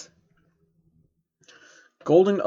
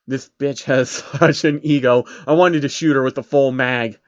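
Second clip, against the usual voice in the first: usual speaking voice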